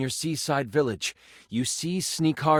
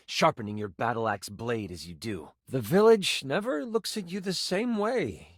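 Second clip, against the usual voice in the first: usual speaking voice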